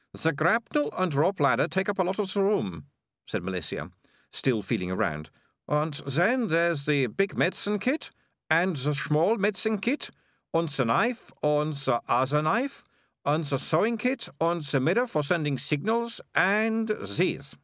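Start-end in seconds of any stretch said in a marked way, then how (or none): 0.1-2.9 put-on higher, bright-eyed girl voice, confident; lightly voiced eager girl. A narrator's reading. Malicia boasting to Morris, higher girl voice. Malicia's voice, listing her kit. 5.7-17.6 each item lifted at the end, pitched up; higher girl voice listing eagerly. A narrator's reading. Malicia showing Morris her bag, eager list.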